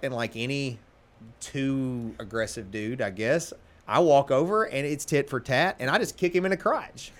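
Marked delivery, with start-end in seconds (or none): none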